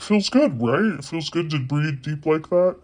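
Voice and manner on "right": normal talking voice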